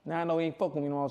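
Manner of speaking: ordinary talk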